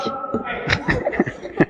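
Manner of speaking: soft high wheezy laughter; real breathy giggling laugh. Real, not acted. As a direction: crack up quietly at your own punchline. Laughs at own donkey punchline.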